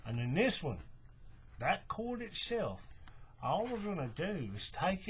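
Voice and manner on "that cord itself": ordinary delivery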